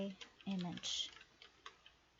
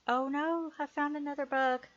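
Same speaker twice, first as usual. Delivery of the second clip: surprised; 7 semitones higher, 6 dB louder than usual. Real, not acted. Finds another bug while coding.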